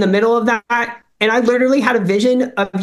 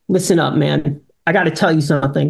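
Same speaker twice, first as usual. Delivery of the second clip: lower, grave rabbi voice; serious rabbi; 6 semitones lower than usual. Retold or imitated. Rabbi sits him down, low and grave. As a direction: drop low like a stern talk.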